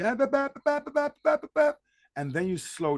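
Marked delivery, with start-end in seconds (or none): 0.0-1.8 high-pitched, rushed nonsense syllables like a raised voice in an argument; mock heated babbling. Someone losing it in a hot argument, high-pitched babbling rant. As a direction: squeal out nonsense as if ranting mid-fight. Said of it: "there's gonna be".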